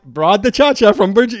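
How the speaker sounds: high, loud, chummy announcement; overfriendly driver, imitated. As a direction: play the driver pleased with his offering. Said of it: "He's being friendly".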